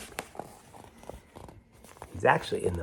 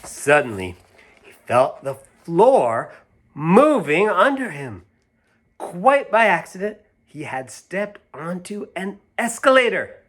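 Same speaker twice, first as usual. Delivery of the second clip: higher and louder, slow building wonder; dramatic narration; 7 semitones higher, 5 dB louder, slower than usual. A narrator's reading. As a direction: read it as the floor starts moving, slow and rising.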